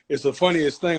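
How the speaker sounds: ordinary talk, nothing marked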